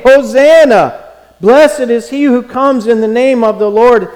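loud, high shout of acclamation; crowd crying out. Retold or imitated. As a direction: call it out like a jubilant crowd. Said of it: "they were crying out".

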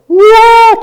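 high, loud "whoa" of elation; mock-ecstatic burst; exultant whoop. Shown as a demonstration. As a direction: let out a big high whoop. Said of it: "And I can go from".

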